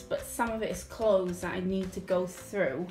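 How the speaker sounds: ordinary talk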